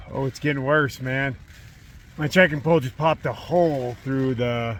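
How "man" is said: normal talking voice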